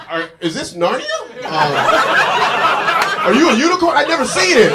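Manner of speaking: high, loud, over-the-top wonder; mock amazement. To his girlfriend in the shower, mock astonished and loud. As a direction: gasp at her like she's a unicorn.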